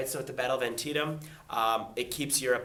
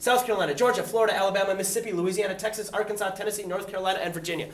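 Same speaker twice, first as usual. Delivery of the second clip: double speed; 5 semitones higher, 4 dB louder, faster than usual. Shown as a demonstration. Teacher drilling the class on seceded states, double speed. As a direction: rattle off the states at double speed, higher and louder. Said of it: "Double speed".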